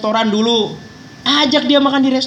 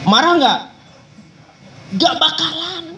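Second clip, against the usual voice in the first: ordinary delivery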